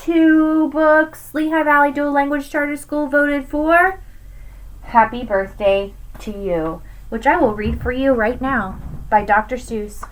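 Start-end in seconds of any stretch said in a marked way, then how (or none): none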